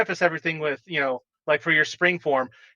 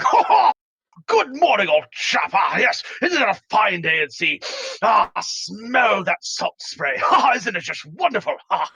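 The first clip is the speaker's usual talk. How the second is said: hearty, laughing, much higher and louder at the start; boisterous greeting; 5 semitones higher, 3 dB louder than usual. Acted. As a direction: greet the sailors with over-the-top cheer. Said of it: "boisterously greeting them like".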